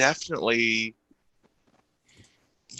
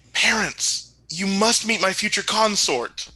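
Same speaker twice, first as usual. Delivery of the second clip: gasp, then a higher eager announcement; breathless princely delight, pitched up; wide-eyed excitement; 9 semitones higher than usual. Acted. Prince presenting a future consort to parents, wide-eyed and excited. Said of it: "this wide eye".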